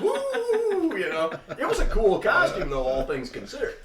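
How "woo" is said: excited whoop. Retold or imitated. Toddler showing off costume.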